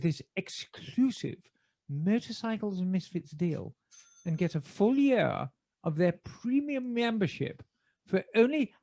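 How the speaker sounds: measured BBC announcer reading an ad. Acted. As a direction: deliver the sponsor read in your best BBC British. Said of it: "Can I do it in my best B B C voice".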